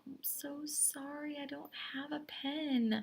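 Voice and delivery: super polite. Shown as a demonstration. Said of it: "You have to be super polite".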